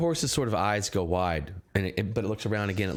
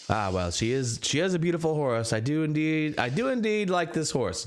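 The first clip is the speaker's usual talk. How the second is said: proud cab driver; 7 semitones higher than usual. Acted. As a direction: brag about the horse. Driver praises his horse.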